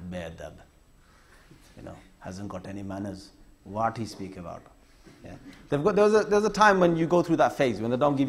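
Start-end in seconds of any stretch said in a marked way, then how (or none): 0.1-4.6 low, much softer disapproving mutter; disapproving teachers. Retold or imitated. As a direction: grumble it under your breath like a scornful teacher. Teachers giving dirty looks.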